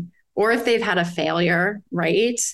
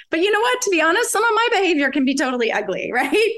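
laughing; 10 semitones higher, faster than usual. Real, not acted. Laughs at her own admission.

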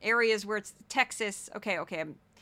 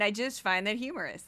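ordinary delivery

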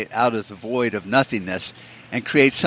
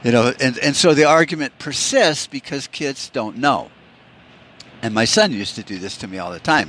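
ordinary talk, nothing marked

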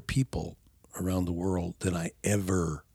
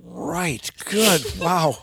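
1 mock sarcastic wow; 7 semitones higher, 5 dB louder, much slower than usual. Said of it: "It's like".

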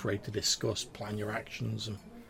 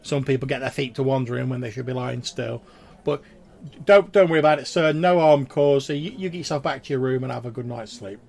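loud, raised friendly barmaid; 4 semitones higher, 11 dB louder than usual. Acted. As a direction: reassure him loudly as the barmaid. Barmaid keeps talking.

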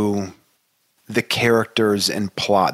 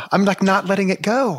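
high, excited, close to laughing; elevated voice; 10 semitones higher than usual. Real, not acted. Joking with friends about not letting go, elevated voice. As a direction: keep the pitch up, refusing to drop it. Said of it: "talking in an elevated, elevated voice".

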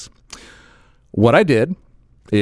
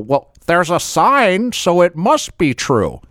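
higher, slow, exaggerated gullible earnestness; mock bureaucrat; 6 semitones higher, slower than usual. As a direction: mimic a small-minded rule follower, drawn out. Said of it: "that's what I like to call these people, the people".

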